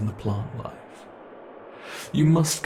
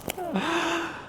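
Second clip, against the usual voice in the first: contented sigh; 3 dB quieter than usual. Acted. Monster delights in prey's panic.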